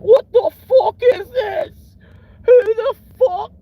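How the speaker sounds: very high, loud, slow squeal of outrage; mock outraged British guy. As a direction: shriek it like a furious Brit. UK dude imitated, outraged.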